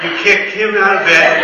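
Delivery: loud, laughing jab; laughing tease. Real, not acted. Pastor teasing Steve about the crying baby, loud and laughing. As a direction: laugh as you tease him.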